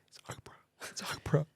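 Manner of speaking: whispering the name to a friend; mouthed whisper. Retold or imitated. Mouthing to his friend outside the play, whispering. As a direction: barely breathe it out so only he hears. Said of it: "I'm sort of mouthing to my friend".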